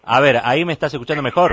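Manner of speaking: speaking up over a bad phone line. Real, not acted. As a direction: raise your voice so the caller hears. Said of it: "No, hable más fuerte".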